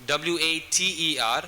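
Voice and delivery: usual speaking voice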